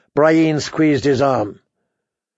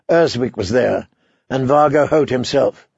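ordinary delivery, nothing marked

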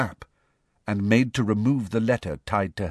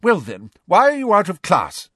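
stern teacher; 10 semitones higher, 7 dB louder than usual. Acted.

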